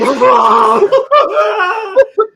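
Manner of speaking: loud, high mock crying scream; hammed-up, high-pitched bawling; imitated child's wail. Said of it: "crying her goddamn eyes out, yelling".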